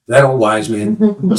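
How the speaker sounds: usual speaking voice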